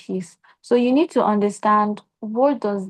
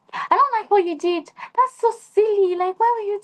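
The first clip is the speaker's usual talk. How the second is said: much higher, squeaky mock scolding; tiny angry voice; 12 semitones higher than usual. She imitates her tiny voice scolding a partner, high and squeaky. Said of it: "my voice was so tiny".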